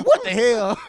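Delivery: loud startled laugh-out-loud what; shocked at being told to watch a suicidal kid; shocked laughing yell. Retold or imitated. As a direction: blurt it out laughing in disbelief.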